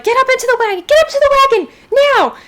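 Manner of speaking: high, loud shout to the family; panicked shouting. Acted. Woman in the long grass shouting her family into the wagon, high urgent shout. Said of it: "she's shouting around".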